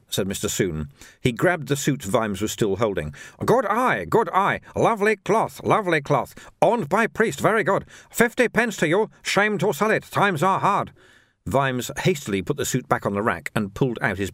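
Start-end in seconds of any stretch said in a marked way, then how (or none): 3.4-10.9 high-pitched shopkeeper in broken English; eager little shopkeeper. A narrator's reading. Shopkeeper pushes a suit on a customer, high eager patter.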